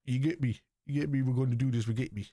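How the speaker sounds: lightly put-on London street slang; mock London accent. Imitating what you're gonna hear, mock London accent. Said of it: "Whatever happened to the London accent?".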